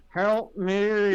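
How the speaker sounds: forced through clenched teeth; strained, teeth clenched, pleading; through gritted teeth. Pretend. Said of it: "Through gritted teeth".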